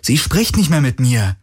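Galen, startled and defensive; young hero voice. A narrator's reading. (leicht höhere Stimme eines jungen Helden)

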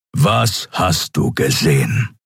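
slow, gruff interrogation; cold Keru. Acted. Keru questions Kai about what was seen, cold and gruff. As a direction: space the words out coldly. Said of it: "wiederholte er mit einem kalten Blick".

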